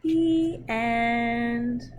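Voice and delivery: slow, expressive storybook reading; reading to children. Acted. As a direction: read it gently to little kids, taking your time. Reads picture book to children.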